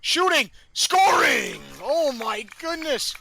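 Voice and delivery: shouted, high goal celebration; goal call. Real, not acted. Commentator calling the equalising penalty goal, ecstatic shout. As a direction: roar the goal out high and loud.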